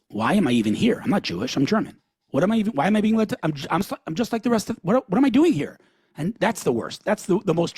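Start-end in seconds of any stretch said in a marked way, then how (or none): 0.1-5.8 high, rising, rushed confusion; bewildered victim. Retold or imitated. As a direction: act out a baffled man climbing in pitch. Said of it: "asking themselves".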